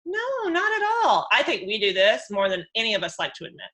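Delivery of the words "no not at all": fake sweet denial. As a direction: deny the anger in an overly sweet voice. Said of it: "He's like".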